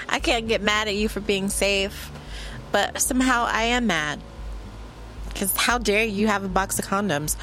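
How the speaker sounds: usual speaking voice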